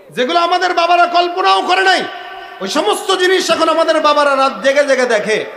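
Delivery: high, loud sermon shout; shouted preaching. Real, not acted. Preacher decries late-night phone watching, shouted preaching. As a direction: cry out the complaint to the crowd.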